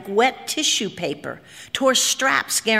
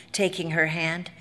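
plain reading voice

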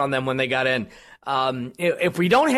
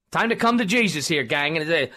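ordinary delivery

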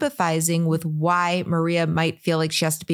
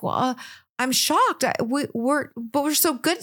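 higher, halting stammer of hurt disbelief; mock shocked; 5 semitones higher than usual. Host acts how he should have said it, stammering in shock. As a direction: act out a stunned, protesting boyfriend. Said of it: "he doesn't even say it like".

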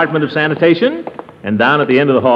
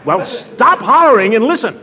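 raised voice to be heard; shouting over noise; 12 semitones higher than usual. Real, not acted. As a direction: call out above the din of the pump. Shouting over a noisy pump.

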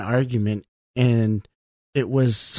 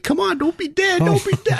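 high, pleading "don't be dead"; pleading with horse; 3 dB louder than usual. Retold or imitated. Player begging his dying horse, high and pleading. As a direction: beg the horse not to die, up high.

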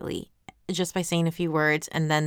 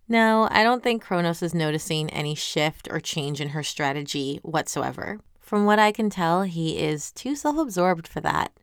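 ordinary talk, nothing marked

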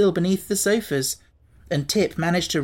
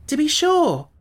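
Tip's confident answer; 12 semitones higher, 3 dB louder than usual. Acted.

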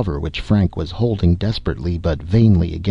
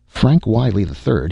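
ordinary delivery

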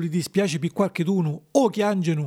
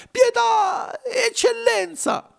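grido acutissimo e lento; supplica piangente; much slower than usual. A narrator's reading. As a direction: implora piangendo. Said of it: "chiangendo a cieli pierti". (Pinucciu implora eccellenza, piangendo forte)

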